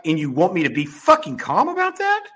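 high-pitched, indignant retort; exasperated retold comeback; incredulous anger. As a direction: throw the question back in disbelief. Acting out his airport argument.

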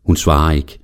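ordinary delivery

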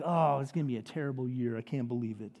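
low, soft deflated moan; hammed-up gloomy defeat; mock discouragement. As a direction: sink into a dejected grumble, imitating a sudden letdown. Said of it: "something bad goes down".

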